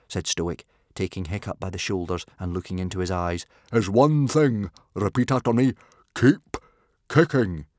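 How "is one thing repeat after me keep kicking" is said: booming drill command. A narrator's reading. Stoic making Hiccup repeat his motto, booming.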